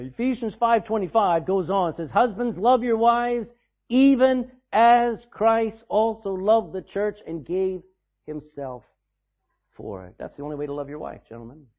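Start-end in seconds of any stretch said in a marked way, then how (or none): 2.1-10.1 scripture reading. Acted. Reads scripture slowly, then fades.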